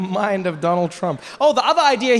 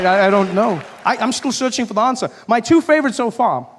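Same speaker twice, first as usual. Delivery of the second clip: ordinary talk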